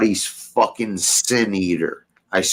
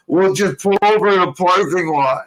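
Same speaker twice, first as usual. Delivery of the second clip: mock drunk uncle; 8 semitones higher than usual. Mimics uncle's drunk suggestion.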